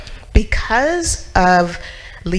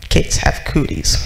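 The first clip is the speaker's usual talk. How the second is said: lower, breathy grumble; grumpy old man; 6 semitones lower than usual. Retold or imitated. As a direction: mutter it like a grouch. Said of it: "Mister Smith would be like".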